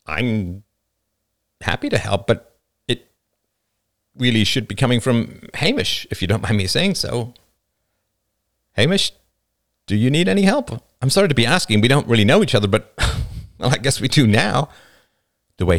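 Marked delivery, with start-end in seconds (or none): 0.1-7.3 hesitant, mildly uncomfortable father; awkward. A narrator's reading. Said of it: "He cleared his throat awkwardly". 8.7-14.7 higher, tentative offer with a chuckle; father, awkwardly kind. Acted.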